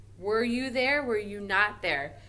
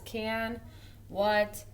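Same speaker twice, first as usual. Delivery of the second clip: ordinary delivery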